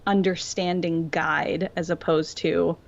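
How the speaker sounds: ordinary talk, nothing marked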